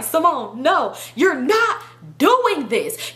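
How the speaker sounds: slow, drawn-out mimicked refusal; measured mock plea, stretching the words; toned-down friend protest. Friend telling Simone no, slow toned-down protest. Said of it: "Let me talk right".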